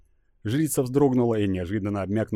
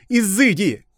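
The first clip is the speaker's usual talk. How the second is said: commanding shout; 13 semitones higher, 8 dB louder, slower than usual. Acted. Priestess banishes the goddess.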